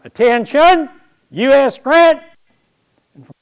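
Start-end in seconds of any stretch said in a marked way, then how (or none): 0.0-2.2 high, loud parade-ground bellow; drill sergeant yell. Retold or imitated. Drill sergeant yells the new cadet's name on parade, loud bellow. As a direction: bellow the roll call across the field. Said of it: "the drill sergeant yells out".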